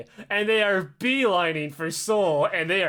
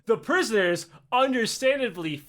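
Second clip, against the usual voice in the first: ordinary talk, nothing marked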